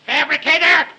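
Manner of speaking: high, loud outburst; angry accusation. Acted. Boss calls the servant a liar, angry shout. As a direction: hurl it as an accusation.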